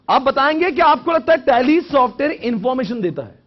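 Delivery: high, loud question to the room; loud challenge. Real, not acted. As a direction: throw the question forcefully at the class. (ऊँची आवाज़ में सवाल दागते हुए)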